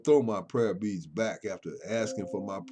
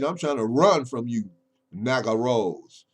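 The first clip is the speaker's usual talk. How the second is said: normal talking voice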